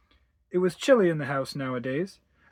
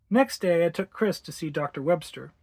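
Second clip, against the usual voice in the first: ordinary delivery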